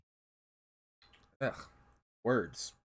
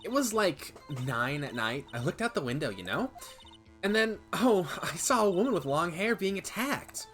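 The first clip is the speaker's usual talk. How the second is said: put-on valley girl; 3 semitones higher than usual. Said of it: "Do a Valley Girl accent?".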